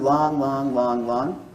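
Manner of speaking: drawn-out, evenly spaced LONGs; long beats. Shown as a demonstration. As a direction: hold each LONG for two beats. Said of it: "each long is two beats".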